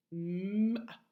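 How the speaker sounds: M, a. split into separate sounds with a pause between. Shown as a demonstration. Teacher sounding out M, a. for the children. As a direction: sound out M then a. slowly. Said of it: "Nous allons décomposer la syllabe".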